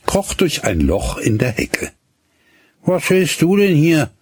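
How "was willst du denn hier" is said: lightly voiced boy, cool and offhand; cool boy Hinnerk. A narrator's reading. Hinnerk asks the girl at the hedge coolly. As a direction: ask it coolly, keeping him at a distance. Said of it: "fragte Hinnerk sehr kühl".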